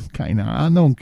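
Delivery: whining. A narrator's reading. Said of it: "jammerte er".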